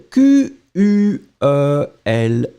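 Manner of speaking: slow letter-by-letter spelling; slow spelled-out letters. Shown as a demonstration. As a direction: spell each letter out slowly. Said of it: "So quelle".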